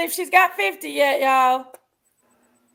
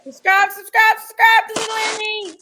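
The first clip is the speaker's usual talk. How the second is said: excited calling out; 6 semitones higher, 5 dB louder than usual. Real, not acted. Urging viewers to subscribe.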